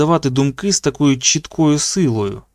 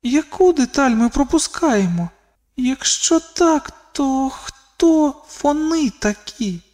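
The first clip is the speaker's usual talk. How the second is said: високий відсторонений внутрішній голос; повільно розмірковуй уголос високим голосом; думки Каліші; 13 semitones higher, slower than usual. A narrator's reading. Думки Каліші, питання собі. (високий, протяжний голос думок)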